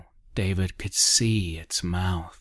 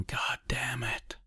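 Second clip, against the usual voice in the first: mock yell, pitched up but held soft; a yell kept small, higher and quieter; understated narrated outburst; muted yell; 6 semitones higher, 6 dB quieter than usual. A narrator's reading. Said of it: "Lindstrom yelling".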